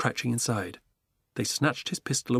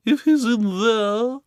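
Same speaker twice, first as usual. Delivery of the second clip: high, loud, slow diva cry; Duchess crying out; 11 semitones higher, 7 dB louder, much slower than usual. Acted. Duchess demanding the burglar, high loud cry. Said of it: "cried the Duchess".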